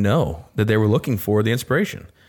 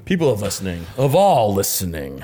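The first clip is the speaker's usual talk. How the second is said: town crier. Pretend. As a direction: announce it like an old English herald. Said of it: "living in fourteenth century England".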